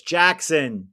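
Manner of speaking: usual speaking voice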